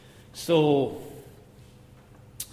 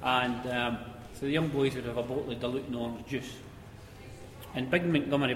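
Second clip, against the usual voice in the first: ordinary delivery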